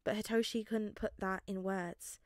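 plain reading voice

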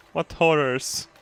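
ordinary talk, nothing marked

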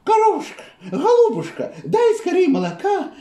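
high, urgent little hen; pleading hen voice. Acted. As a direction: beg like a frantic hen. Hen begging cow, high voice.

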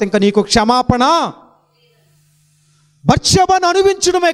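high, slow, furious condemnation; burning anger. Acted. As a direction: rebuke him as a wicked man. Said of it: "అని కోపంతో రగిలిపోయి". (కోపంతో రగిలిపోతూ గద్దించడం)

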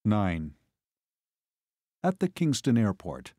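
ordinary delivery, nothing marked

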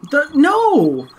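sudden high excited shout; excited yell. Real, not acted. Frantic directions in game.

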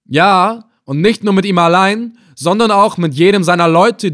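defiant captive raising his voice; emphatic threat. Acted. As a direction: drive the threat home. Captive presses his threat on captor.

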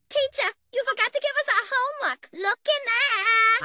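put-on high little kid pleading; whiny kid voice. Kid whining to the teacher about homework, high voice. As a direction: play a small child whining up high.

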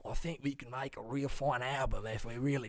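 earnest mock-Australian plea; Australian accent. Bandmate proposing a last album, mock Australian accent. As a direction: put on Australian for a comeback pitch. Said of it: "or, like, Australian, like the Bee Gees".

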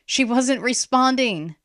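high, slow, anxious report; worried. A narrator's reading. Boy who cannot reach his sister, worried. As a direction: break bad news anxiously.

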